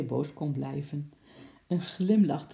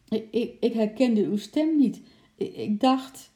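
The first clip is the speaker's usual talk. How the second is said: higher, louder stuttering excuse; 7 semitones higher, 6 dB louder than usual. Acted. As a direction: stammer out the excuse in alarm. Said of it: "stotterde Bart".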